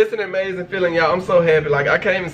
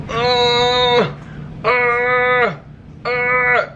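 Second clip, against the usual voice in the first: three short strained grunts, higher; imitated pushing grunts; 6 semitones higher, much slower than usual. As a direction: mimic her straining to push. Retelling wife pushing in labour.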